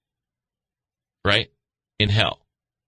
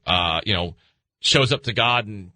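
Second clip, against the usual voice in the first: usual speaking voice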